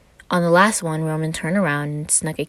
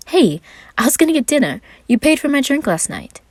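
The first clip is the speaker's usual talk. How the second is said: higher, louder indignant protest; Roman protesting; 10 semitones higher, 6 dB louder than usual. Acted.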